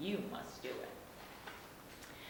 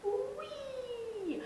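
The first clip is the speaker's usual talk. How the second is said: much higher gleeful whoop; whee. Acted. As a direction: squeal like on a ride. Bus zooming onto bridge.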